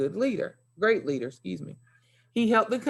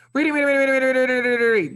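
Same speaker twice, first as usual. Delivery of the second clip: rattling off reading, reading, reading high, loud and fast; fast rushed reading with no pauses; speed demon reading; 8 semitones higher, 7 dB louder, much faster than usual. Shown as a demonstration. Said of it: "speed demon".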